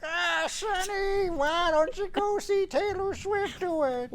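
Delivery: put-on high, slow, creaky old granny; grandma voice. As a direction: call out like a doting grandma. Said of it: "you should do it like your grandma voice".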